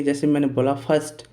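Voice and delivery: normal talking voice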